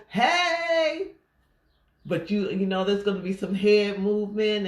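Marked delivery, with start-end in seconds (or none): 0.1-1.1 high, drawn-out party "hey"; stretched, high-pitched cheer; dancing whoop. Acted. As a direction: call it out like you're on the dance floor. Acting out exuberant dancing.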